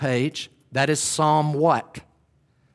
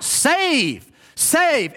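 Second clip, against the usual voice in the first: put-on children crying out; 8 semitones higher than usual. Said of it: "these little children crying out".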